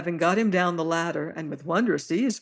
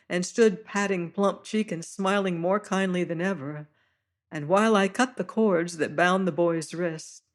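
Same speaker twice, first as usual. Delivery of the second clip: ordinary delivery, nothing marked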